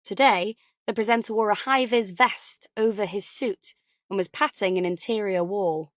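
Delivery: usual speaking voice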